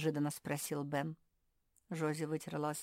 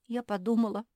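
high, loud, tearful protest; plaintive, wounded whine; acted sobbing; 6 semitones higher, 8 dB louder than usual. A narrator's reading. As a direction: insist through pretend sniffles. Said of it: "всхлипнула Жози".

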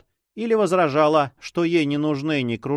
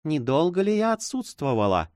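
smiling question; 3 semitones higher than usual. A narrator's reading. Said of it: "улыбаясь".

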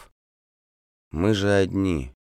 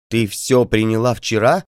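loud, higher, frantic question; wild voice; 5 semitones higher, 7 dB louder than usual. Acted. Doctor alarmed at the patient, wild loud question. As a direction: blurt it out in alarm. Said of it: "спросил я диким голосом".

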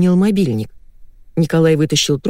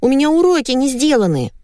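put-on high-pitched child's squeak; squeaky little girl; 9 semitones higher than usual. A narrator's reading. Little girl on television, squeaking. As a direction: pipe up like a small girl. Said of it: "запищала маленькая девочка".

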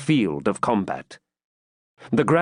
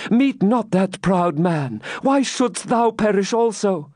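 pleading maiden; 6 semitones higher than usual. Acted. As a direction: beg him not to fight. Rebecca pleads with Ivanhoe.

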